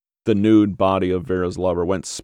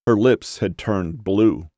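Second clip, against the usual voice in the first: ordinary delivery, nothing marked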